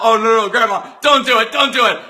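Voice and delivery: rising mock panic in an inner voice; anxious pleading. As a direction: beg grandma to hold back. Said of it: "you're thinking to yourself".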